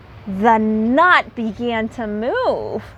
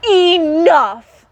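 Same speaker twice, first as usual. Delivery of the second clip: higher, louder stern enough; stern call; 6 semitones higher, 6 dB louder than usual. Acted. Badger to the squabbling animals, stern and loud. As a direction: cut them off firmly. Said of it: "said Badger".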